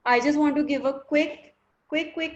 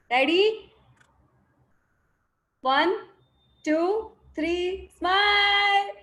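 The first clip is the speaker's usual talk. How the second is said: higher, sing-song countdown to the group; photo countdown call; 6 semitones higher, 4 dB louder than usual. Real, not acted. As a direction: call out the count, lifting into a long held last word.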